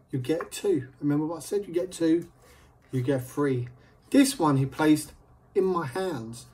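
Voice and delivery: ordinary talk, nothing marked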